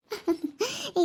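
soft high-pitched laugh; light laugh, falling at the end. Acted. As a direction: let out a quiet, high giggle. Laughs amid the skit quarrel.

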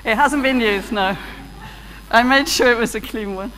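ordinary talk, nothing marked

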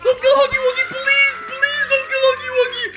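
high, whiny mock begging; hammed-up pleading. Viewer begging for Huggy Wuggy's life, hammed-up pleading. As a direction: beg in a squeaky, overdone wail.

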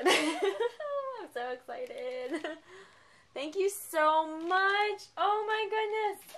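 overwhelmed, laughing and welling up; laughing, near tears. Real, not acted.

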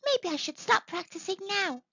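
high, quick tadpole voice; little tadpole dreaming of being a frog; eager tadpole. A narrator's reading. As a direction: think it aloud, keen and small. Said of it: "he thought".

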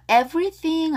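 "everything" with a clear v; V sound. Shown as a demonstration. Teacher warning learners, v marked in "everything". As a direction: say "everything" minding the v. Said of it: "V 발음".

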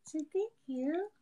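pet talk. Real, not acted. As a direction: coo it to the puppy. Baby talk to the dog.

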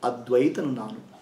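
soft, slow meditation voice with long pauses; guided meditation. Acted. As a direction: guide them inward, gently and unhurried.

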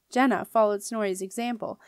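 plain reading voice

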